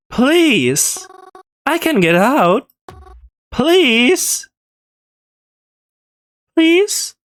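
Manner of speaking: high, frantic pleas to be let out; panicked screams. Acted. Trapped in a coffin, begging Reginald to open it, panicked. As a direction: scream for help, terrified and trapped.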